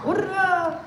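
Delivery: high-pitched hurrah; cheer. Pretend. Host celebrates with the audience, cheering. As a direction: lead the crowd in a light cheer.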